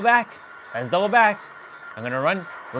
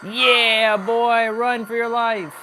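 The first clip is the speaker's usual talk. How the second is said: hype chase call; 5 semitones higher, 4 dB louder, much slower than usual. Acted. Killer hyping the chase.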